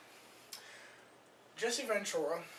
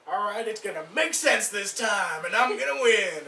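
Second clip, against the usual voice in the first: loud, higher, psyched-up voice; hopeful gambler; 4 semitones higher, 8 dB louder than usual. Acted. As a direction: pump yourself up with rising hope. Hopeful viewer, like lottery player.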